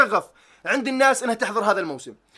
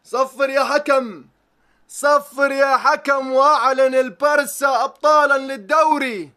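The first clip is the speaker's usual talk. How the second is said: slow, drawn-out title-winning call; stretched, ringing final-whistle commentary; football commentator; 3 semitones higher, 3 dB louder, slower than usual. Acted. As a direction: announce the championship like a match commentator. Performs a match commentator's title call.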